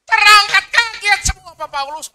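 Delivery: high, loud "kaget semua" burst out; excited shout. Acted. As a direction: shout it to the congregation in amazement. Loud retelling of ascension.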